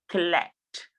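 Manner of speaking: collect as kuh-LEKT; weak first syllable, strong LECT in collect; stress on second syllable. Shown as a demonstration. Teacher pointing out her pronunciation, collect stressed on LECT. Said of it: "The stress is on let".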